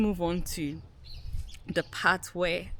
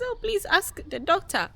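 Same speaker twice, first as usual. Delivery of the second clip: pleading high; 12 semitones higher than usual. Retold or imitated. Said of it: "I said".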